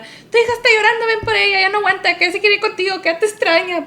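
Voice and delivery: high, loud urgent quote; urgent phone plea. Retold or imitated. Dad phoning mom that her daughter is crying, urgent and high.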